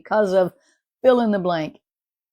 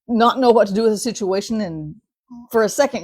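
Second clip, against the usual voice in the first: ordinary talk, nothing marked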